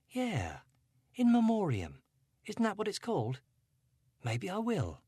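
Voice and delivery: Angela, a touch brighter; teen girl brightening. A narrator's reading. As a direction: let her lift with a new idea.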